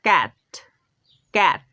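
ক্যাট with short clear অ্যা; word demo cat. Shown as a demonstration. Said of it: "এটা আমরা উচ্চারণ করি ক্যাট". (ক্যাট স্পষ্ট উচ্চারণে)

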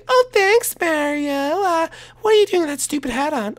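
high, sweet princess, a little snooty. Acted. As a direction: play a high-voiced princess asking teasingly. Daisy character voice.

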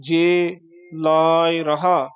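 ਜੇ, a gap, then slow ਲਾਇ ਰਹਾ; slight pause. Shown as a demonstration. Teacher modelling the phrase, slow with pauses. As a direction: draw out ਲਾਇ ਰਹਾ and halt. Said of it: "ਇੱਥੇ ਥੋੜ੍ਹਾ ਠਹਿਰਾ ਦੇ ਕੇ".

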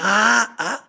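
high, loud excited ah ah ah; fans excitedly shouting. Retold or imitated. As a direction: imitate fans squealing in the street. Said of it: "Caminando por la calle".

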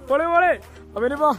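high, loud, thrilled cheer; excited cheering. Real, not acted. Cheering a friend's jump.

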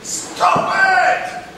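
high sharp yell of an upset man; angry shout. Retold or imitated. Upset stranger yelling at him in the restroom, loud. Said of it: "he comes right up to me and he goes".

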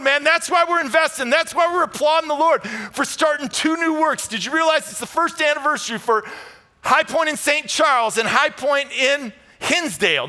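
high, loud rising sermon climax; preaching at full force. Acted. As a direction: preach it out, lifting the whole room.

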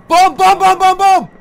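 loud, high repeated warning; urgent shout. Real, not acted. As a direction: bellow the warning. Warning of falling bombs.

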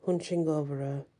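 plain reading voice

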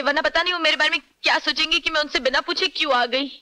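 anxious pleading. Acted. Girlfriend begs his mother to let her go, anxious pleading. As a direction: plead quickly, fretting about being caught.

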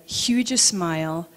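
usual speaking voice

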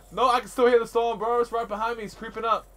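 high, loud panic; panicked. Real, not acted. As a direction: gasp and panic. Storm closing in.

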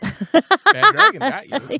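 high, slow, loud ad voice; squeaky announcer voice. Pretend. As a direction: sing it like a cheesy ad.

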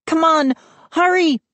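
put-on high, urgent girl's plea; Mindy pleading. A narrator's reading. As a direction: beg him to hurry. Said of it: "Mindy pleaded".

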